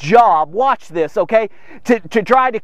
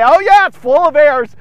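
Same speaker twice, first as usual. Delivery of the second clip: higher, louder mocking quote; mock scoffer; 4 semitones higher, 6 dB louder than usual. Preacher mimicking people who fault the Bible, scoffing. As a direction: toss it out loudly like a cocky doubter.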